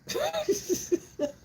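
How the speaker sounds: high amused cackle; high cackling laugh. Real, not acted. Laughing at the governor.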